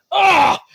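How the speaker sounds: sudden high startled yelp; a sharp high cry of alarm; confused yelp. Acted. Dazed foe yelping as he gets hit. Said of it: "He gets confused and goes like".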